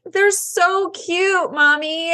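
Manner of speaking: imitating her daughter gushing through tears; teary little girl. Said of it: "And she's like".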